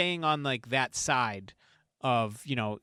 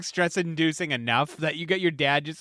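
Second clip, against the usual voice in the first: ordinary talk, nothing marked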